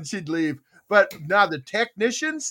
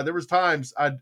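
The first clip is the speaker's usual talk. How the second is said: usual speaking voice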